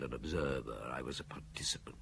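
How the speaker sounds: whispered, barely voiced; hushed admission. Maigret admitting guilt to his friend, whispered.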